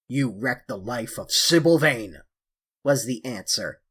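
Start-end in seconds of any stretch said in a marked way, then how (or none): 0.1-2.2 a touch of a grim avenger; vengeful brother. A narrator's reading. James Vane accuses Dorian.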